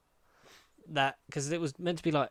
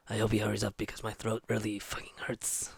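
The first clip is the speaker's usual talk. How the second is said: hoarse whispered complaint; real whisper from a strained throat; 4 semitones lower, 7 dB quieter than usual. Real, not acted. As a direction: whisper to spare a sore throat. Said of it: "that really hurt my throat".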